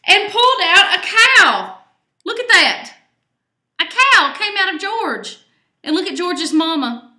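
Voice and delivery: high, loud gasp of surprise; amazed reveal. A narrator's reading. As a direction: reveal it like a shock. Fourth reveal.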